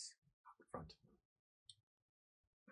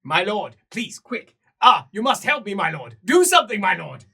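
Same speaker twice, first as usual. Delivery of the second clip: high, loud, desperate begging; frantic pleading; 10 semitones higher, 20 dB louder than usual. Acted. Servant begs his lord for help, frantic. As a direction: plead for rescue.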